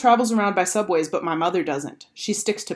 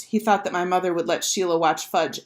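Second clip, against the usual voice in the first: plain reading voice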